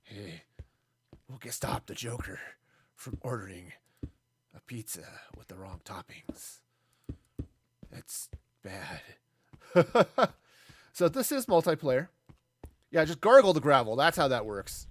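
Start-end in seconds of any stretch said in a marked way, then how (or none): 0.0-9.0 low, whispered gravelly hero growl; Batman voice. Pretend. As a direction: growl it like a brooding superhero. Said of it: "his nice gravelly voice".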